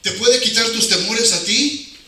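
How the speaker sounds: ordinary delivery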